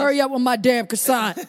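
loud, sudden mock-demanding outburst. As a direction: shout it like a demanding diva. Interrupting with an acted demanding line.

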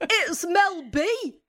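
put-on slow, high, wrong-accent [name]; [name] impression. Guest tries [name] and lands on Paddy McGuinness, slow and high. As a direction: drag out [name] like a Bolton TV host. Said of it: "you sound like Paddy McGuinness".

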